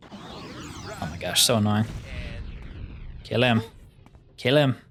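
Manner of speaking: ordinary talk, nothing marked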